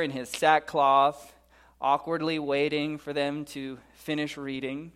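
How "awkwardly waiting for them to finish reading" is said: ordinary delivery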